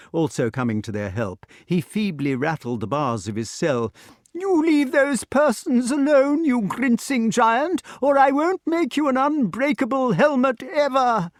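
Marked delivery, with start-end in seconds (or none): 4.3-11.3 put-on high, loud defiant old man; indignant inventor. Feeble inventor defying the giant, high and loud. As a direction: scold the giant from the cell.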